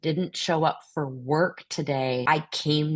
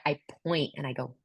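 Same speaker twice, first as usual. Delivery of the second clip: ordinary delivery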